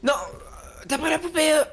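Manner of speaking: being chased. Real, not acted.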